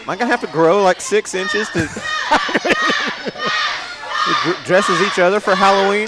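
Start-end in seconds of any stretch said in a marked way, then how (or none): 1.9-4.4 high laugh. Real, not acted. Laughs at Halloween idea.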